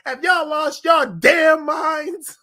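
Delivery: loud, slow, high furious yell; angry shout. Retold or imitated. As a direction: yell it at the whole room.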